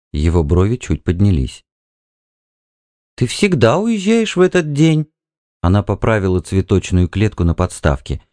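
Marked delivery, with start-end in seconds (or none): 3.1-5.1 high-pitched wifely inquiry; wife's question. A narrator's reading. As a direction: ask in a raised woman's voice. Wife questions her husband. (высокий голос жены, с вопросом)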